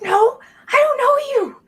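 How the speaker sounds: put-on high, loud, strained child voice; yell-whispering kid. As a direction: fend off a stranger as a scared kid. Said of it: "yell whispering at you down the alley".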